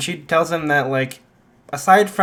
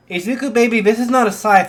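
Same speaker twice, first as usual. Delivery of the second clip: high, raised-voice bafflement; taken-aback mom; 8 semitones higher, 6 dB louder, faster than usual. Acted. Mom holding her transformed son, taken aback. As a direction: point out the problem, high and startled.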